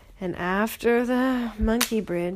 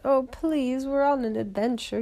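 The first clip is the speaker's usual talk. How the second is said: higher, louder begging plea; pleading; 6 semitones higher, 4 dB louder than usual. Acted. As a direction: beg the troll to let you pass. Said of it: "Monkey and Tiger said".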